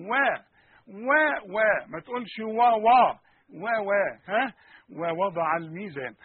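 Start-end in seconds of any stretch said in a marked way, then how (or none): none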